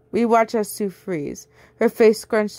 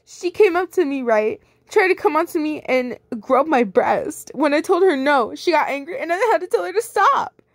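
lightly voiced fake victim; a hint of whiny girl; high whiny accuser; 8 semitones higher than usual. Sue's lying accusation voiced.

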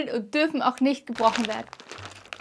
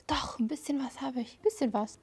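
hushed whisper to herself; soft unvoiced murmur; 11 dB quieter than usual. Real, not acted. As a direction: whisper while concentrating. Quietly filling the brush pen.

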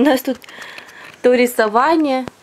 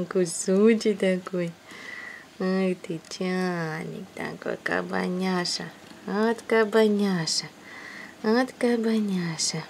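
cooing at a pet; 4 semitones lower, 7 dB quieter than usual. Real, not acted. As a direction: coo tenderly at a kitten. Cooing at the cat. (сюсюкая с котом)

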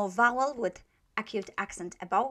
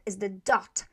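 normal talking voice

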